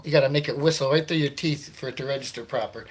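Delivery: ordinary delivery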